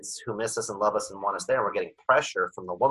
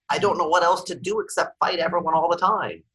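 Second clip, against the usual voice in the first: higher-pitched, exasperated plea; mock cry for help; 8 semitones higher, 3 dB louder than usual. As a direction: act out a desperate call for help. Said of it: "they're screaming for help".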